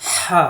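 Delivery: হা with a strong airy rasp from the throat; long pharyngeal হা; throat-pressed h. Shown as a demonstration. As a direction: squeeze the throat on হা and hold the a. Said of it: "কণ্ঠনালীতে চাপ দিয়ে".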